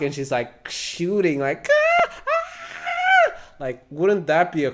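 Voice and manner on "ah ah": hammed-up high shouted cries, much higher and louder; mock battle screams. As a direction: yell like firing a gun in panic. Acting woman shooting at zombie.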